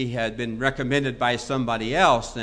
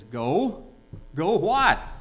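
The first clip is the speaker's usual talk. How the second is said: puzzled question; 4 semitones higher, 4 dB louder, much slower than usual. Acted. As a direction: question the command, lost. Bewildered client answers the command.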